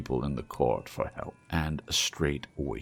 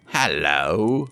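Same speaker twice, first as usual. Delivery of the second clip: loud, put-on grumpy bark; feigned gruff growl; 7 dB louder than usual. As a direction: fake the old grumpy boss. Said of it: "growled Scrooge, in his accustomed voice, as near as he could feign it".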